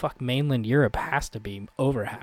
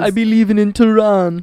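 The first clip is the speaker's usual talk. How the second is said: bad Iranian accent; 8 semitones higher, 10 dB louder than usual. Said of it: "the Iranian man".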